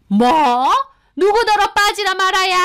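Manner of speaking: high-pitched outraged yell; furious mother-in-law. Acted. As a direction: explode at being told to butt out. Mother-in-law told to stay out. (노발대발하는 시어머니의 고함)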